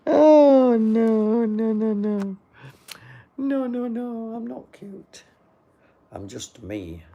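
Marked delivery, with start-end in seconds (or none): 0.1-5.2 very high, drawn-out wailed no; hammed-up falsetto denial, easing off at the end; mock protest. Streamer refusing a viewer's compliment, high mock protest.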